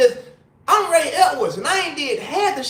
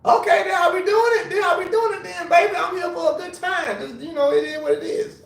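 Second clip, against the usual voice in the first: tipsy excited; 6 semitones higher than usual. Retold or imitated. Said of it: "I'm sitting here like".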